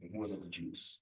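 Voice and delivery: quiet mutter to self. Real, not acted. Teacher quietly rechecks the book title to himself, muttering. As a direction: murmur the title to yourself, confirming it. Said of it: "I had it right".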